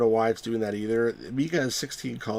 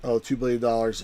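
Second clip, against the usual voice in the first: ordinary talk, nothing marked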